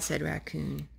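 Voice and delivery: usual speaking voice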